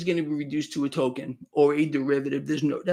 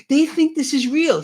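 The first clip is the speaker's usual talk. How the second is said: higher and louder, mocking the elites; mocking sneer; 10 semitones higher, 5 dB louder than usual. Host mocks politicians laughing at voters, in a high, louder sneer. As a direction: sneer it like a gloating insider.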